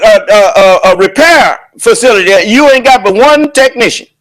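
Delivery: ordinary delivery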